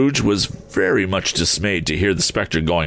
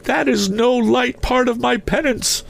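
very high, wailing phantom voice; mournful ghost. A narrator's reading. Ghost refusing comfort to his old partner, mournful high voice. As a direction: intone it high as a restless spirit. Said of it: "pursued the ghost".

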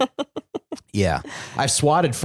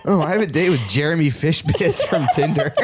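higher, amused play-acting through giggles; laughing mock realization; 5 semitones higher than usual. Pretending to have a Tinder date, laughing. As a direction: play the dater while cracking up. Playing co-host's date, cracking up.

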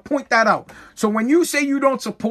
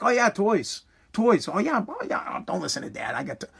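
sweet mom; 6 dB quieter than usual. Retold or imitated. Mom sweet-talking kid behind dad.

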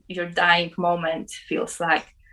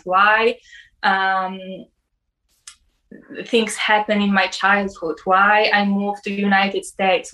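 ordinary talk, nothing marked

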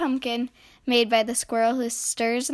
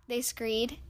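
usual speaking voice

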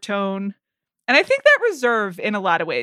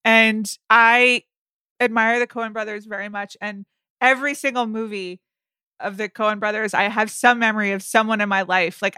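ordinary talk

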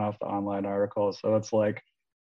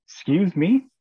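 stunned disbelief; 9 semitones higher, 6 dB louder, slower than usual. Retold or imitated. Told someone is inside house.

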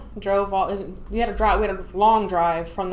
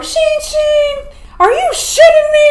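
high, loud exasperated yell; drill instructor shout; 10 dB louder than usual. Retold or imitated. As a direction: holler at the late recruit.

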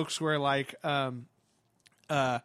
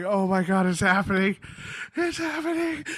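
excited gasp rising to a high squeal; overwhelmed fan; 4 semitones higher than usual. Retold or imitated. Fan seeing Mark Hamill walk out, rising excited squeal. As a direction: geek out, climbing much higher on the repeat.

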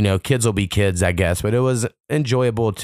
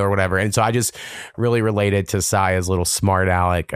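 ordinary talk

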